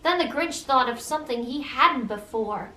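ordinary delivery, nothing marked

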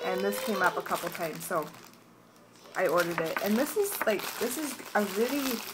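ordinary talk, nothing marked

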